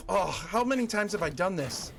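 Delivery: ordinary talk, nothing marked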